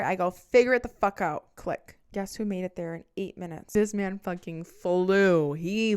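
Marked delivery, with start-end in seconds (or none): 0.3-1.5 loud, higher-pitched exasperated shout; angry order. Retold or imitated. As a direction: shout it down the phone to end the argument. Acted quarrel, hangs up on ex.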